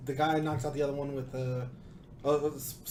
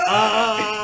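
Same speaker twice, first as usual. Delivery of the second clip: startled yelp; 5 semitones higher, 8 dB louder than usual. Retold or imitated. Guy startled as fighter lunges.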